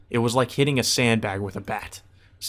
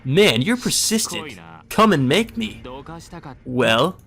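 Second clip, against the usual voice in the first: threatening Shinji; 4 semitones higher than usual. Acted. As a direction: promise pain with a smirk.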